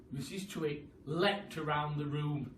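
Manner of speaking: usual speaking voice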